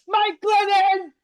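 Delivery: a high outraged yell at the answer; exasperated shout. Real, not acted. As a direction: shout the name, high and loud, in disbelief. Answering his own rhetorical question.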